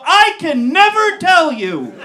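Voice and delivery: loud, high and grave; dramatic refusal. Retold or imitated. Telling his girlfriend, dramatic refusal. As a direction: refuse like a tragic hero.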